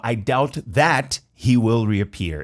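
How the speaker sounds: "I doubt he will reappear" spoken very slowly, "doubt" with no B; very slow demo. Shown as a demonstration. Teacher slowing the sentence for students. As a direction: drag each word out for learners. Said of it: "Mas ele fala".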